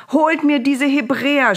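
high, loud frantic yell; shouted order. A narrator's reading. Pharaoh beset by frogs summons the Hebrews, shouting. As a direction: yell it out as a panicked ruler. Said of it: "schrie er".

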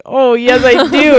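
big, playful confession; emphatic admission. Retold or imitated. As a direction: own up to it with gusto. Emphatic retold admission of crying.

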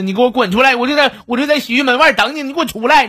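hammed-up angry wife ordering him out; wife yelling. Wife on the phone orders husband out of the bathhouse, mock scolding. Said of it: "劈头盖脸给我是好屌骂啊".

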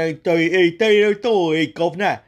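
loud, high hammed-up Cockney British; mock British accent. Mocking the actor's British to viewers, hammed-up British accent. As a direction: overdo the British accent with governor. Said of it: "you start speaking British".

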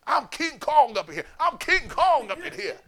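high and loud, swaggering; a film hero boasting; Denzel's King Kong line. Retold or imitated. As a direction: strut it out. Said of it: "He's like".

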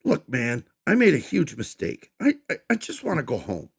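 high, loud stammering whimper; stammering plea. Acted. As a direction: beg to go home, stuttering. Captive pleads.

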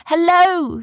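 high, loud hail; eager ringing shout. A narrator's reading. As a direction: call out to get noticed far away. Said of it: "shouted Arthur".

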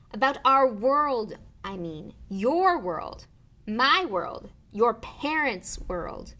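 "your world, my world" punched loud and high; exasperated emphasis. A narrator's reading. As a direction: hit each kind of "world" slowly and loudly. Exasperated Hagrid explains.

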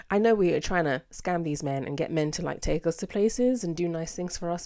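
ordinary talk, nothing marked

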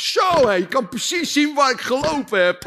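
loud, high, proud boast; cheeky Peter. Acted. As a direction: show off the dirty footprints.

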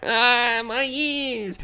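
high, softened, drawn-out wail; mock agonized wail. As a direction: imitate a man wailing in pain, held back. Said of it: "just freaking out".

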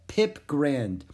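usual speaking voice

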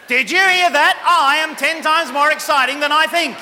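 high, loud, thrilled boast; excited boast. Shown as a demonstration. Speaker modelling a boast for the audience, high and thrilled. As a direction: brag about it with delight. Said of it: "Now turn to the person on the other side and say".

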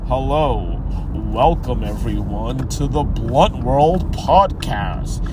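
overly proper welcome to listeners, hammed-up; Ani. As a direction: make fun of a too-proper host, low and measured. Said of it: "make fun of his voice".

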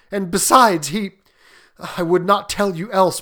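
loud, troubled, faltering appeal; shaking voice. A narrator's reading. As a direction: plead in horror, breaking off mid-thought. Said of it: "and his voice shook".

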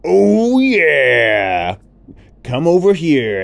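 high, loud, booming happy elephant; big cheerful elephant voice; jolly elephant. Acted. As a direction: boast about being noisy. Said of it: "the elephant said".

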